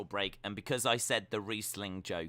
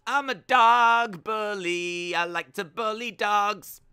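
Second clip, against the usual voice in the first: mock villain boast; 9 semitones higher, 10 dB louder, slower than usual.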